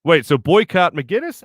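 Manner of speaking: usual speaking voice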